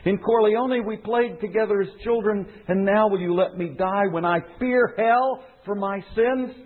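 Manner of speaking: high-pitched dying man's plea, read aloud; urgent, high narrated begging; desperate pleading. A narrator's reading. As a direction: beg for your life, voice lifted high without shouting. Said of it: "The quavering voice grew louder".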